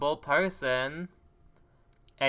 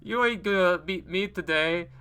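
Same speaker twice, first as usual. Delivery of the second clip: gloating taunt; 5 semitones higher, slower than usual. Acted. Gloating after overtaking rival.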